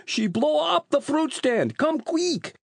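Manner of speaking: put-on very high, drawn-out Italian-accented cry; panicked Italian fruit seller. A narrator's reading. Rocco urges the police to his stand, panicked Italian cry.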